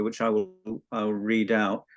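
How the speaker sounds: ordinary talk, nothing marked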